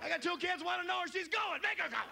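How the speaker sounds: high-pitched furious yelling; pissed-off neighbor. Acted. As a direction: yell your angry demand at the noisy couple. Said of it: "pissed off".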